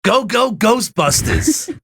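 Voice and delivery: high, drawn-out New York holler; cartoon New Yorker yell; fake New York catchphrase. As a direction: ham up the New York accent and stretch the last word. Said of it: "that Stanley did".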